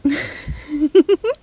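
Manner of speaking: loud, high rising giggle; gleeful villain's laughter; witch's mocking cackle. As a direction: laugh loudly at their plight. Witch mocks the victim.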